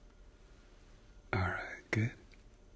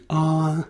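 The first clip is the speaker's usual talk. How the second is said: saying ahh; 4 semitones higher, 3 dB louder than usual. Shown as a demonstration. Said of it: "one, two, three".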